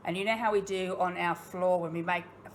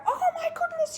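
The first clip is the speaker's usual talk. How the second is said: mock startled shriek; 5 dB louder than usual. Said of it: "I go".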